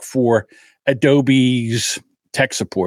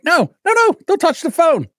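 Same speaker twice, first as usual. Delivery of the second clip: high panicked yelp, much higher; panicked plea. Retold or imitated. Comic retelling his panic at the support line, high squeal. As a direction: squeal it like you're begging them not to hang up. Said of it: "I'm like".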